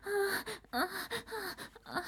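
high broken moaning cries; climax moans. Acted. Crying out as the countdown nears its end. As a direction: let short cries break out high.

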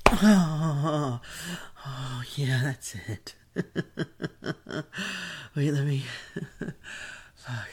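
breathless and panting; out of breath. Real, not acted. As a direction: gasp between words. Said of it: "Let me catch my breath".

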